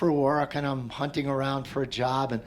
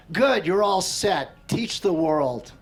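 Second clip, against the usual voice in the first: louder, slow and firm; imitated firm dismissal; 3 semitones higher, 6 dB louder, slower than usual. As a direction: send him off with a slow, firm command. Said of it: "And he goes".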